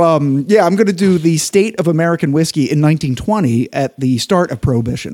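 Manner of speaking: usual speaking voice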